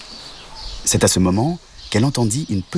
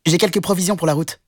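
higher, eager offer; little rabbit; 9 semitones higher, faster than usual. Acted. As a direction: rabbit proud of his carrots.

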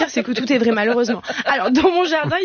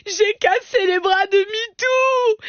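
much higher, wailing and sobbing; crying child; 9 semitones higher, slower than usual. Retold or imitated. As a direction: wail like a heartbroken little boy. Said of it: "il commence à pleurer".

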